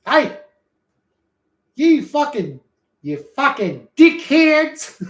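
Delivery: loud, slow Aussie shout; Australian accent. Pretend. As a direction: yell it like Steve Irwin. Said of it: "Steve Irwin".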